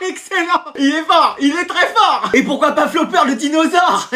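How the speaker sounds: giggling high through the words; laughing. Real, not acted. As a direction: talk while cracking up at the joke. Schüler lacht über eigenen Witz.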